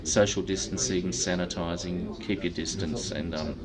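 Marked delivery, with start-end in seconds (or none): none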